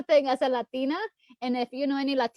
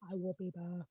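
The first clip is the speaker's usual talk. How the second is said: quiet echo; 5 semitones lower, 13 dB quieter than usual. Real, not acted. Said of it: "but I will be back".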